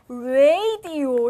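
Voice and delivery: 라디오 drawn out as English "RAY-dee-oh"; exaggerated English "radio"; American pronunciation. Shown as a demonstration. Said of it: "이렇게 쓰지 않아".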